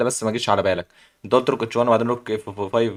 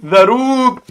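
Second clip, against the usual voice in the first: excited call; 10 semitones higher, 6 dB louder, much slower than usual. Real, not acted. Announcing the move.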